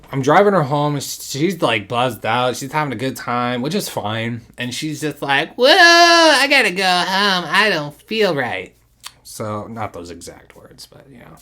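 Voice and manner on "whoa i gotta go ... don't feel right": put-on much higher, slow whiny drunk voice; imitating drunk girlfriend. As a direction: mimic her woozy complaint.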